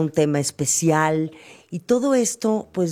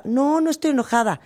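negando el enojo; 8 semitones higher than usual. Retold or imitated. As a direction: niega el enojo con tono más agudo. Mujer niega estar enojada.

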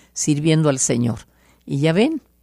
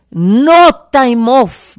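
booming slow shout, much higher and louder; God shouting; 8 semitones higher, 10 dB louder, much slower than usual. Retold or imitated. The Lord shouting at her in the bedroom, a slow booming shout. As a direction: thunder it out as the Lord commanding. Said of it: "me gritó, pero recio el Señor".

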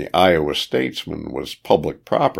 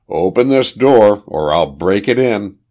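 firm threat, starting louder and higher; narrated threat, raised voice without a full shout; forceful demand; 4 semitones higher, 5 dB louder than usual. A narrator's reading. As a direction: order it through a locked door. Man threatens through a locked door.

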